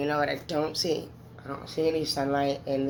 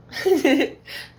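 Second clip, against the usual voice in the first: a burst of high, loud laughter; high teary laugh; 10 semitones higher, 7 dB louder than usual. Real, not acted. Laughing through tears on camera.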